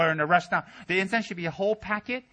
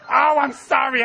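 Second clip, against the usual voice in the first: flustered apology; 9 semitones higher, 10 dB louder, slower than usual. Retold or imitated. Incense guy burns Rinpoche.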